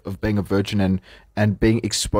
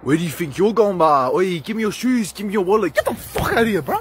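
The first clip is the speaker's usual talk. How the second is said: mock robber; 8 semitones higher than usual. Acted robbery skit.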